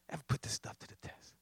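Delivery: David's quiet, whispered admission. Acted. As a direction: drop it to a whisper the second time. Repeat dropped to a whisper.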